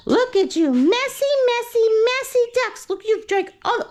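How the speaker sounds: high sing-song scolding of pets. Real, not acted. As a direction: coo at the messy ducks like babies. Cooing at messy ducks.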